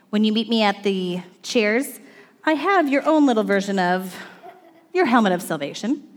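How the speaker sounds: ordinary talk, nothing marked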